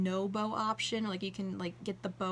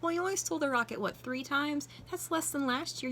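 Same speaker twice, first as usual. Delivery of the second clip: high, playful mock scolding; teasing scold; 6 semitones higher than usual. Vlogger teasing Stitch about the stolen rocket, playful high scold. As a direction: tease him like a fond parent.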